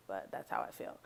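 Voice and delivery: muttered. Real, not acted. Muttered after rude joke.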